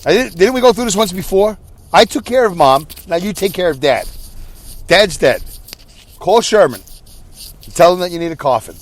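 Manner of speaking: hammed-up bossy indignation, laying down orders; mock angry. Brother ordering sister to arrange dad's burial, mock angry. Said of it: "That's how I'm gonna sound".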